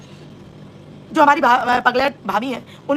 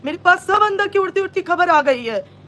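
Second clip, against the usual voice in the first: mock quoting a man; 5 semitones higher than usual. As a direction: mimic his teasing hints of inside news, pitched up. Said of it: "तो इसने ये बोला कि". (चिढ़ाते हुए ऊँचे सुर में नकल)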